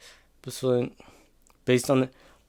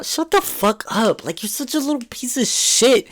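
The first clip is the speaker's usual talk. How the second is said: high, slowed-down telling off; exasperated scolding; 8 semitones higher than usual. Real, not acted. Fan snapping at Henry on the show, high exasperated scold. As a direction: spit it at the screen character.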